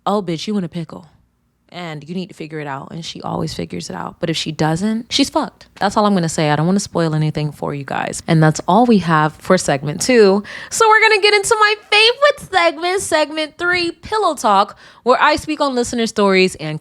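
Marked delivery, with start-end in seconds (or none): 0.0-2.9 1 knowing aside. Retold or imitated. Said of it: "This one was just". 10.7-14.8 much higher, bright host announcing; excited announcement. Real, not acted. Host introducing her favorite segment to viewers, excited high voice.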